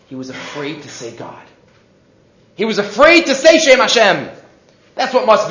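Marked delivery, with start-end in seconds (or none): 0.1-1.4 very soft, low and careful; hushed reverence. Retold or imitated. Teacher on Esav fearing God's name, hushed. 2.6-4.3 higher, animated insistence; emphatic repeat. Real, not acted. Teacher explaining Esav's fear to the class, emphatic.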